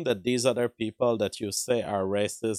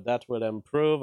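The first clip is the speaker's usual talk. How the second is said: ordinary talk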